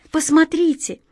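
high-pitched eager call. A narrator's reading. Girl urging her uncle to look, calling out. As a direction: call out, pitch lifted well up. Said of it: "позвала Катя".

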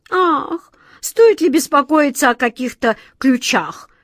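spoken through a yawn. Acted. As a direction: yawn the words out lazily. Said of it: "зевнул кот Васька".

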